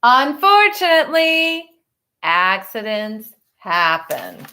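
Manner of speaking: put-on reading a picture book to children; story narration. A narrator's reading. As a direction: tell it gently to the class. Teacher reads picture book aloud.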